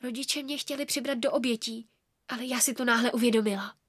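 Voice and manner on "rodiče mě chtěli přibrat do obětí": plain reading voice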